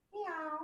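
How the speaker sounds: high, soft little kitten meow; cat meow. Shown as a demonstration. Yoga teacher to kids, high soft cat meow. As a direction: mew like a small cat, up high. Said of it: "Cat, round your back".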